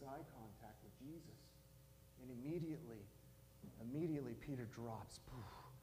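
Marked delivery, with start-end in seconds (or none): none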